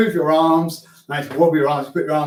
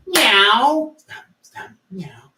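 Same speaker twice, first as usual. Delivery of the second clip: high playful meow; cat meow; 5 semitones higher, much slower than usual. Shown as a demonstration. Teacher leading a rhythm game, meowing high like a cat.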